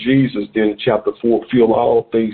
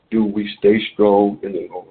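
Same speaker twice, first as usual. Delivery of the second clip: normal talking voice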